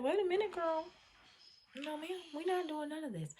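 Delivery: high-pitched mock telling-off; retold frustrated scolding. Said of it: "I was extremely frustrated".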